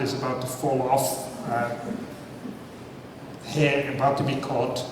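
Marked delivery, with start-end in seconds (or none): none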